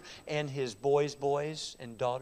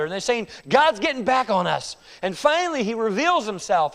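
louder and higher, building the drama; rising dramatic preaching; 8 semitones higher, 9 dB louder than usual. Real, not acted. Preacher building the brothers' scene for the congregation, loud and high. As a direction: preach it big, the scene climbing.

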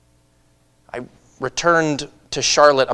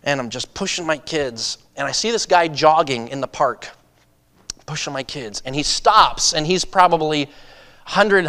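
ordinary talk

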